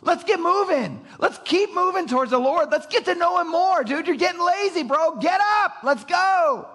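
a believer urging a friend to keep growing; rousing call. Retold or imitated. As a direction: fire up a lazy friend to get moving. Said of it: "there's an automatic admonishment".

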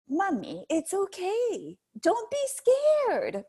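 put-on very high, slow child voice; toddler reassuring. Mother imitating her toddler coaxing her not to be scared, very high and slow. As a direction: coax mommy like a tiny child, drawn out. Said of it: "she's like".

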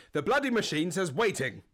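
imitated posh, well-spoken accent; posh man. Furious posh man to driver at jet wash, imitated posh voice. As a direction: lightly put on a posh man's indignation. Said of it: "very well-spoken, quite posh man".

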